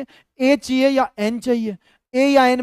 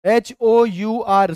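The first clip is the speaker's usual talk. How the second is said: letters read out very slowly; slow spelling; much slower than usual. Shown as a demonstration. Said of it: "ये शब्द देखो".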